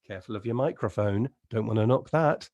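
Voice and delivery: ordinary talk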